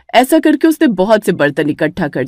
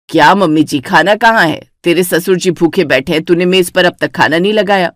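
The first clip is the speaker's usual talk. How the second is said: stern mother-in-law; 2 semitones lower than usual. Acted. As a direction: scold the bickering daughters-in-law. Mother-in-law scolds bride.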